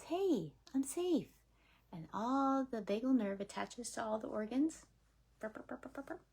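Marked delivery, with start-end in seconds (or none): 0.0-1.3 higher, bright little voice of the body; a cheerful inner voice saying all is well; body's voice. Acted. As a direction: speak as the relieved nervous system. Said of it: "it says".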